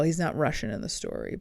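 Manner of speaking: ordinary delivery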